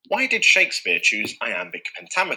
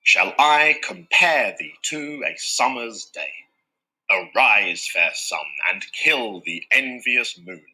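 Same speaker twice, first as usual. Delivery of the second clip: slow, measured recitation with the iambic beats marked; iambic pentameter reading; slower than usual. Shown as a demonstration. Teacher reciting famous Shakespeare lines in slow, marked iambs. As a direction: recite it so the da-DUM pulse is heard. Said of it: "used for many of Shakespeare's most famous lines".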